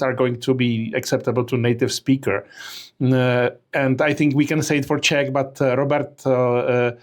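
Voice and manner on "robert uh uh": normal talking voice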